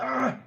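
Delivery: put-on the little girl losing her temper at a missed shot; frustrated child's yell. Daughter missing the cup, frustrated high yell. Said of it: "She's like".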